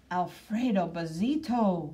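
ordinary delivery

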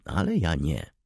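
ordinary delivery, nothing marked

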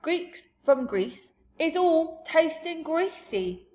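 giant's slow, loud pronouncement; slow booming giant. Acted. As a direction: savour the word greasy like a giant.

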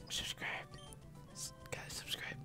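hushed whisper to viewers. Pretend. Asking viewers to subscribe, whispered. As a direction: whisper it like a secret plea.

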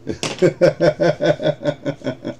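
drawn-out high laughter; long giddy laugh. Real, not acted. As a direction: laugh until you can hardly stop. Laughing at the lucky hit.

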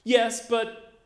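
put-on high, loud, indignant child's accusation; angry kid. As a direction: demand it like a betrayed kid. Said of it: "So I'm really angry".

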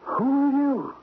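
high, startled gasp of a question; frightened cry. Acted. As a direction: jump in fright at an unseen voice. Startled by a talking statue.

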